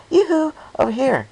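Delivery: very high, loud sing-song yoo-hoo; cheerful call across the room. Acted. Pinkie Pie waving from a far table, calling loudly and high. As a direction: hail a friend from the far end of the room.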